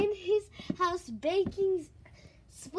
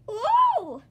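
high, loud "whoa"; startled yell; 8 semitones higher, 11 dB louder than usual. Acted. Kicked character in a story fight, loud startled cry. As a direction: cry out as if knocked flying.

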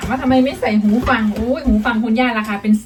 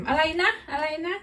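imitating grandma's "what?"; 6 semitones higher, 5 dB quieter than usual. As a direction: imitate a granny asking for a repeat, pitched up and softer. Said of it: "คุณย่าก็ยังพูด เห็นไหม เวลาเมลีถาม".

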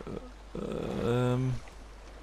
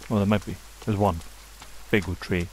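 ordinary talk, nothing marked

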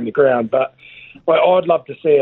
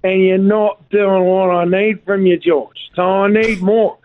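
rinsing halftime spray; 5 semitones higher, slower than usual. Retold or imitated. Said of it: "The great George Smith said".

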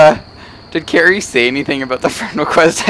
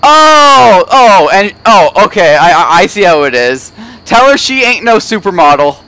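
mock offended; 5 semitones higher, 7 dB louder than usual. Mock outrage at wife's insult.

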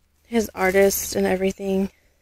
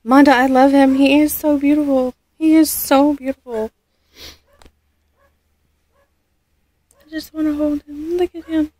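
a touch of tearful joy, higher; happy tears; 4 semitones higher, 4 dB louder than usual. Real, not acted. Thanking Manda for the baby, tearful and gushing. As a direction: gush with tears in the voice.

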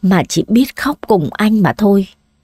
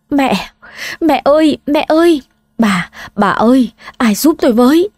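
higher, urgent calls for help; panicked cry; 4 semitones higher than usual. A narrator's reading. Father-in-law calls to his fainted wife, panicked cry. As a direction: cry out in alarm as she faints. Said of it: "hoảng hốt kêu lên".

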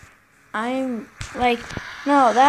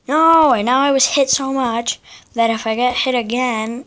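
ordinary talk, nothing marked

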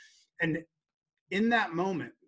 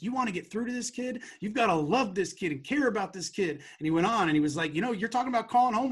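higher, louder earnest lecture; impassioned mentor; 7 semitones higher, 4 dB louder than usual. Retold or imitated. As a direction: drive the advice home like a caring coach. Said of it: "And he's like".

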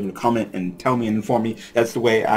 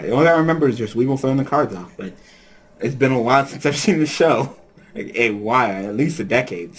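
ordinary delivery

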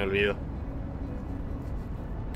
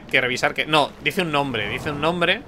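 ordinary talk

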